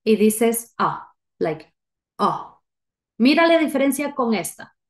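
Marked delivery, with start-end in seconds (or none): 0.8-1.1 open-jawed short "ah"; short A sound. Shown as a demonstration. Teacher showing the English short A, jaw dropped. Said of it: "abres tu boca como que fueras a decir". 2.2-2.6 brief "ah" with dropped jaw; short A sound. Shown as a demonstration. Teacher models short A for learners, dropped-jaw short vowel. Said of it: "dejas caer tu mandíbula".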